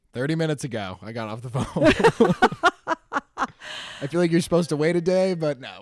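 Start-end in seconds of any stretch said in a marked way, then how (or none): 2.4-4.0 big, high burst of laughter; loud high-pitched cackling laugh. Real, not acted. Loud burst of laughter while chatting.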